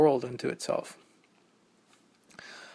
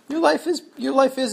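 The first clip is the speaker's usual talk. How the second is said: high emphatic; 10 semitones higher, 7 dB louder than usual. Acted. Sudden high emphatic start.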